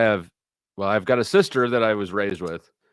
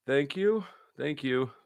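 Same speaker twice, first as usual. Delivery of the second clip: higher, softer, touched thank-yous; grateful thanks; 5 semitones higher, 7 dB quieter, slower than usual. Pretend. Thanking whoever dropped the arms, soft grateful voice. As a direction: breathe out gratitude, hushed and moved. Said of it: "it'd be like".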